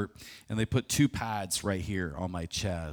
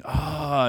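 a sharp retold cry of pain, higher and louder; scream of pain; 6 semitones higher, 6 dB louder than usual. Man in pain on the hospital bed, a sharp cry. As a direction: yelp like an arm caught in a vice. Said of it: "I'm just screaming".

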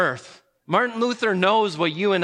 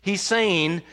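ordinary talk

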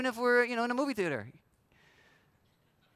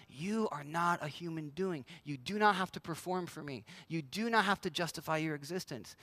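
softer, tender words of God to the soul; gentle God voice; 4 semitones lower, 7 dB quieter than usual. Acted. Preacher voicing God tenderly to a tired soul, softly reassuring. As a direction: speak it softly like a loving father.

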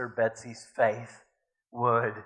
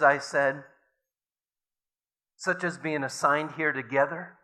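measured, earnest reading aloud; story reading. A narrator's reading. Preacher reading a memoir to the congregation, earnestly.

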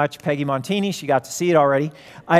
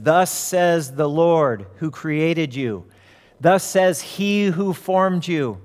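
slow, solemn pronouncement; Jesus; slower than usual. Retold or imitated. Jesus speaks to demon-possessed Mary, slow and solemn. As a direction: declare it slowly, word by word. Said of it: "And he says".

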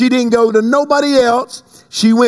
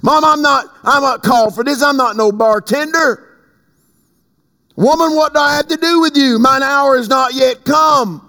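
raised-pitch, lightly acted objection; protesting son; 6 semitones higher than usual. Jesus telling his mother it is not his time, protesting. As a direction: push back at mom, pitched up.

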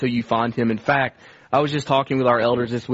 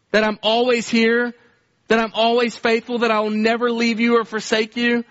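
a lightly voiced father, pitched higher; a father correcting his son, gently firm; slightly raised, lightly voiced fatherly rebuke; disciplining father; 7 semitones higher than usual. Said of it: "the voice of a father disciplining him".

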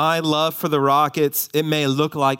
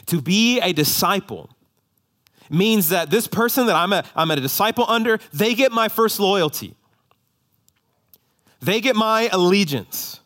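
raised, building preacher voice; preaching; 6 semitones higher, 3 dB louder than usual. Acted. Pastor preaching discipleship to the church, rising and forceful.

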